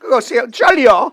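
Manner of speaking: slow hammed-up British accent, Cheerio drawn out; playing posh Brit for a laugh; mock British accent. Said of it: "British accents".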